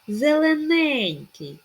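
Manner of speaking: "Зелененький" slow, high and loud on нень; "Зелененький", drawn out on stressed нень; stress on third е. Shown as a demonstration. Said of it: "Наголос на третю е".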